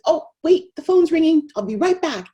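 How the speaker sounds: high, loud sudden exclamation easing off; interrupted mommy. Acted. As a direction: break off as the phone calls you away. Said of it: "mommy goes to pick her up and says".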